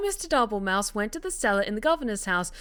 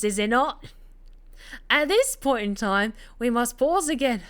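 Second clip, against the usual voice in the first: melodramatic fake Cockney narration; bad Cockney. Narrator building tension as Bernard watches Rita, in bad Cockney. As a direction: play up the drama in badly faked Cockney. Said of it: "To, to do the bad Cockney accent".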